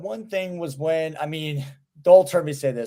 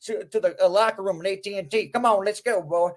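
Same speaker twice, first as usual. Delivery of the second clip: Texas medic; 4 semitones higher than usual. Retold or imitated. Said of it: "They're like".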